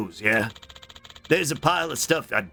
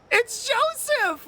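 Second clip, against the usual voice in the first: high slow pet voice; cooing; much slower than usual. Real, not acted. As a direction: coo at the cat. Cooing at a cat.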